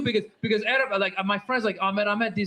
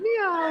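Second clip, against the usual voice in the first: little high meow; short cat meow; 8 semitones higher than usual. Shown as a demonstration. Comedian ends Persian bit with meow.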